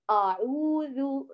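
أعوذ with a heavy, emphatic hamza, drawn out slowly. Shown as a demonstration. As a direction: say أعوذ with the hamza thick and velarized. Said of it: "من يفخم الهمزة فيقول أعوذ".

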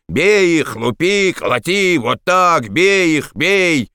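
high, insistent egging-on chant; drunken howling. A narrator's reading. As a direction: yell the mob on in high bursts. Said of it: "и вопил". (истошные пьяные выкрики)

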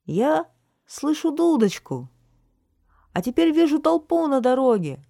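high, excited calls building to a loud shout; Yantye calling out. A narrator's reading. Lookout on tower announcing the returning crowd, excited shouting. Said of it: "крикнул Янтье".

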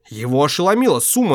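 plain reading voice

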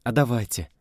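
plain reading voice

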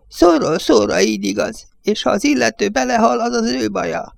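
an aged judge railing against the Yankees; old village judge. Acted.

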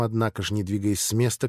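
ordinary delivery

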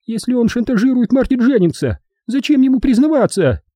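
shrill, high challenge; Edvina's piercing falsetto. A narrator's reading. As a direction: argue in a piercing high woman's voice. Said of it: "раздался пронзительный фальцет Эдвины".

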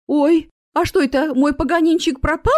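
high, loud, anxious question; worried fretting. A narrator's reading. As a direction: fret aloud over a missing pet. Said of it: "распереживалась женщина".

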